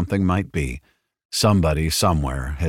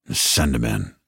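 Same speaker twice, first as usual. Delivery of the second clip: growled; 6 semitones lower than usual. A narrator's reading. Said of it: "he growled".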